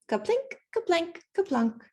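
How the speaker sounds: high, playful plinking sounds; berry plinks. A narrator's reading. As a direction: drop each word like a berry in a tin pail. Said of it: "make a noise like kerplunk".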